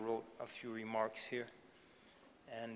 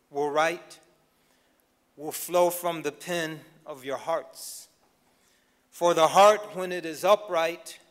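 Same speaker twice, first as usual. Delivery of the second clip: louder, higher preaching build, some words slowed; impassioned commencement exhortation, louder and higher; rising oratory; 5 semitones higher, 13 dB louder than usual. Acted. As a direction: build to a fervent climax exhorting the students.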